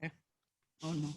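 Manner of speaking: words through a mouthful; chewing while talking; cheeks stuffed full. Real, not acted. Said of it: "Now my mouth is full".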